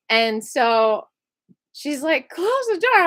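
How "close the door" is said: high, loud barked order; guard screaming. Retold or imitated. Guard yelling at her to close the cell door, high and loud. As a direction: yell the order at the new inmate.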